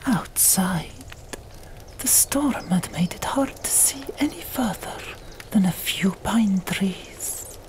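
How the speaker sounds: usual speaking voice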